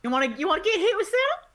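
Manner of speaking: high, loud crowd hype; hype call. Acted. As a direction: pump up the crowd. Announcer hyping the crowd.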